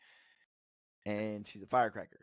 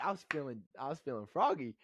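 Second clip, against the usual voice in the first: ordinary talk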